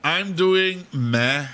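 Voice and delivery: slow, nasal sick voice. Real, not acted. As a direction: sound under the weather. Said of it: "as you can hear".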